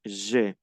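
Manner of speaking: "Je" ending in a clear E vowel. Shown as a demonstration. Teacher repeats the rule for students, "Je" ending in E. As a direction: say "Je" like zhe with a pure E. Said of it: "A I se pronuncia E".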